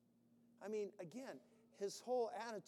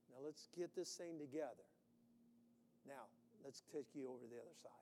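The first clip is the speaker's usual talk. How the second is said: gentle fatherly God; 6 semitones lower, 9 dB quieter, faster than usual. Retold or imitated. God speaking kindly to Dan.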